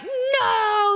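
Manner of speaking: high, loud mocking "No"; mock cry. Mocking him for cueing, high pitched mock cry. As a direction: whine it like a wounded partner. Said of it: "And then be like".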